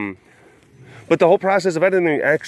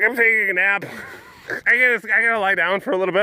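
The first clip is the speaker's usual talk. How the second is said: lazy laptop voice; 5 semitones higher, faster than usual. Pretend. Laptop voice quitting.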